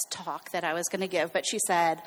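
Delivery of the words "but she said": ordinary delivery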